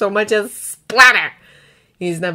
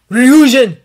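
loud, raised joyful cry; delighted roar; 4 semitones higher, 12 dB louder than usual. A narrator's reading. Dragon to his awakened brother, roaring with delight. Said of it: "roars in delight".

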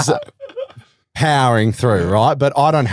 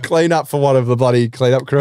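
normal talking voice

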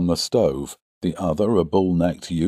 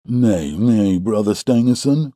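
slightly raised objecting voice; crying out in protest; 4 semitones higher, 5 dB louder than usual. A narrator's reading. Rival suitor objects to his companion, protesting. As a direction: cut in with an objection. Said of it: "cried the other".

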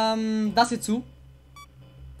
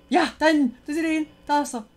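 excited calling out; 5 semitones higher, 5 dB louder, faster than usual. Real, not acted. Spotting him on camera.

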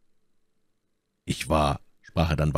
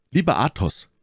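higher, heartfelt exclamation; exclaimed; 8 semitones higher than usual. A narrator's reading. D'Artagnan to the friend who saved him, exclaimed. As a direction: exclaim it with pitch lifted. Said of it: "rief D'Artagnan".

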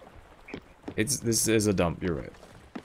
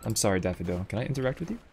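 usual speaking voice

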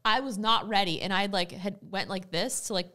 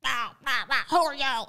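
imitating an angry man's yell, higher pitched; mock shouting at a stranger, raised voice; retold yelling; 5 semitones higher, 4 dB louder than usual. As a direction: act out someone yelling in outrage. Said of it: "like, yelling".

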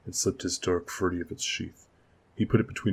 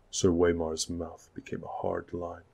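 ordinary delivery

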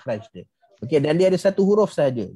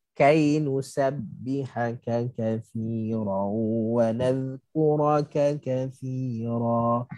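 very slow melodic recitation; Quran recitation; 3 semitones lower, much slower than usual. Shown as a demonstration. Teacher recites kai verse as lin example. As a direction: chant each syllable long and smooth.

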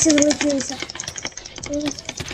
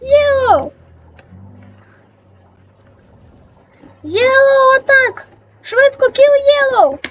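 shouted chat message; 5 semitones higher, slower than usual. Retold or imitated. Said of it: "я в чату написав".

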